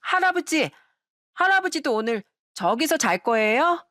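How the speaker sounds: put-on high, innocent little-kid voice; a small child asking grandpa; grandchild's voice. Grandson asks grandpa about sleeping there, in a high child voice. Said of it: "첫째 손주가 ... 얘기를 꺼내는 거였어요".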